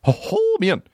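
very high hooting dude; buddy impression. Imitating old roommate, high hooting dude voice. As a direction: hoot high, then drop into "man". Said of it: "he'd say like that".

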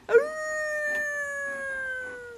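long, high howl; wolf howl. Shown as a demonstration. Showing kids a wolf howl, long high howling. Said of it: "Let me hear your best wolf howls".

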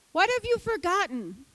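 voice of God. Acted. God repeats the question, far timbre.

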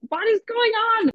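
very high-pitched, baffled outcry; retold exasperation. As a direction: act out being utterly lost and fed up. Retold total confusion at marshal.

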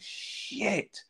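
high, drawn-out mock exclamation; Rodney's curse. Co-host imitating Rodney losing all the data, high drawn-out exclamation. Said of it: "He just goes".

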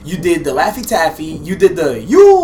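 loud. Real, not acted. Teasing insistence.

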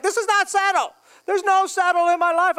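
high-pitched protest of a frazzled parent; mock exasperation. Parents objecting to being told they'll settle down, mock exasperation.

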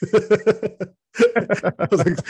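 breaking into a high, drawn-out laugh mid-sentence; laughing so hard the words stretch out. Real, not acted. Telling a friend about his mom, laughing. Laughing while retelling the scene.

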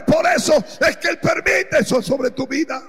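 ordinary delivery

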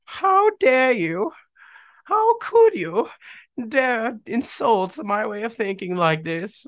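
very high, slow, hammed-up British old lady. Podcaster mocking an embarrassing phrase, old British lady voice. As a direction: squeal it slowly as an affronted old British lady. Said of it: "I don't know why I'm an old British lady".

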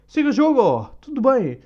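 high, loud offhand brush-off; "Siga o jogo" in a mocking high voice; mocking the careless player. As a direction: toss it off like nothing happened.

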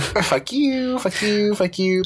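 high mock send-off, easing down; mock taunting. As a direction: pretend to wave off sinking enemies. Echoes taunt at dream enemies.

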